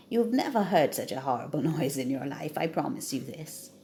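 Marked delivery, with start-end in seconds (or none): none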